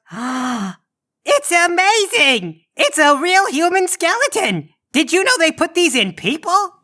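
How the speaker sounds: put-on high, thrilled little monster child; excited kid voice. Krampus Junior gushing over his skeleton gift, high and thrilled. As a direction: squeal it like a kid seeing a toy. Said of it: "He goes".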